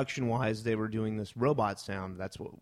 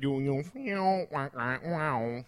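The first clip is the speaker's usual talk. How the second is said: talk box imitation; 2 semitones higher, slower than usual. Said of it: "the big song that he uses that".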